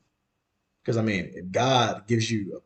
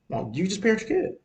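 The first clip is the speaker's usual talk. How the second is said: polite suggestion; 8 semitones higher than usual. Retold or imitated. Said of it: "we say as politely as possible".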